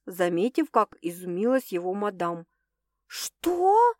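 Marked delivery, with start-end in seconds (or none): 3.1-3.9 high, stunned yelp of a question; asking back in sheer shock. A narrator's reading. Girlfriend hearing her flat was rented out, shocked. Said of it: "шокированно переспросила она".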